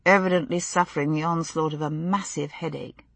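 ordinary delivery

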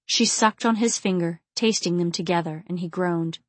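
ordinary delivery

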